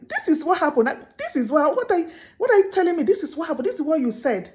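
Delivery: high, loud exasperated protest; angry insisting. Retold or imitated. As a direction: insist hotly, voice climbing with frustration. Angry empath insisting to narcissist.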